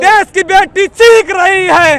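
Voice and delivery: slow, high, loud chanted call; protest slogan shout. Real, not acted. Activist leads the crowd chanting for the daughters, shouting. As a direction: lead the crowd at full voice. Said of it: "पूरी आवाज़ से बोलेंगे".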